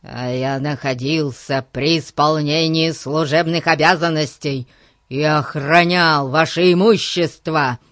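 loud, indignant policeman; angry, forceful self-justification. Acted. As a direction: protest loudly and officiously. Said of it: "Полицейский был страшно рассержен".

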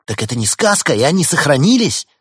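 loud, high, eager question; excited amazement. Acted. A listener learns the rebel robots are real, excited amazement. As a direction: burst out in hopeful wonder.

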